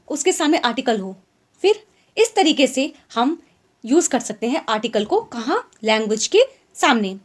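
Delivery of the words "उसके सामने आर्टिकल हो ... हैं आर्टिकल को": ordinary talk, nothing marked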